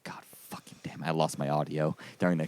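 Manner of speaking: whispered mock self-curse, low and soft; muttered to himself. Friend imagining his sleepless cursing, whispered. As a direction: hiss it under your breath like a sleepless grump. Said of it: "stay up all night and think about, like".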